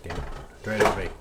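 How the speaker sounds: usual speaking voice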